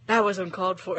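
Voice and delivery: mock-offended, lightly huffy. Shown as a demonstration. Author re-enacts Death, lightly offended. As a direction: deliver it wounded and prim. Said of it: "he's generally offended by that".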